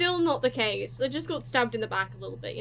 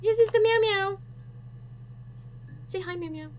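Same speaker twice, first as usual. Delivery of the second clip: pet talk; 8 semitones higher, 3 dB louder than usual. Real, not acted. Streamer coaxing her cat onto her lap, high baby talk. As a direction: coax him up sweetly, much higher.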